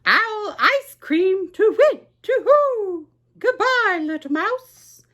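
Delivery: an owl hooting in fright; alarmed owl. Acted. Owl to the mouse, a high panicked hoot.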